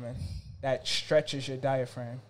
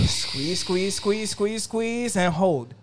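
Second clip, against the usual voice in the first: louder, rising squeeze cue; urgent coaching cue; 6 semitones higher, 5 dB louder, much slower than usual. Real, not acted. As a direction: push them to empty their lungs. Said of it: "rush to squeeze all the air out".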